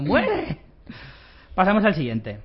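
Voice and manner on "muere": villain cry. Retold or imitated. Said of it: "con una risa malvada ahí diciendo".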